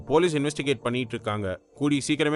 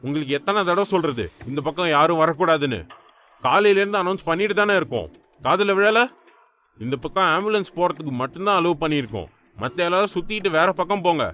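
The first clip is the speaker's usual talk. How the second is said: raised-voice, exasperated reprimand; angry scolding; 3 semitones higher, 4 dB louder than usual. Acted. Policeman turning away a car at roadblock, angry scolding. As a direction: order them back loudly, out of patience.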